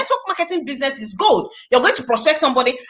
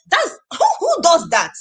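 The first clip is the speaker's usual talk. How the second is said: incredulous; 7 semitones higher than usual. Real, not acted. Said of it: "I said".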